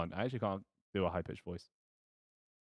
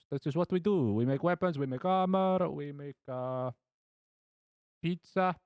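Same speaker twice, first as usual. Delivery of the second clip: higher-pitched, hammed-up Italian blacksmith; mock Italian accent; 7 semitones higher than usual. Italian blacksmith telling customers what he makes, cartoon Italian accent. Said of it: "I'm gonna make him Italian".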